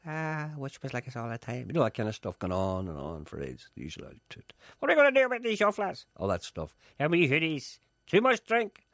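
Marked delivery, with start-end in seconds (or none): 4.8-8.9 high, loud mocking voice; mock TV guest. Mocking the usual guests on the TV chat show, high and loud. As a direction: ham up a moaning talk show guest. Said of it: "the usual talk show chat".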